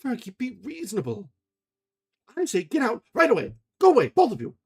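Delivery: high, loud furious order; shouting them out. A narrator's reading. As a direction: drive them out of the room.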